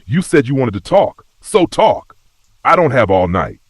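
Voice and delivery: heated, forceful impatience; angry demand. A narrator's reading. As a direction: demand answers, pitch jumping up. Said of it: "I growled".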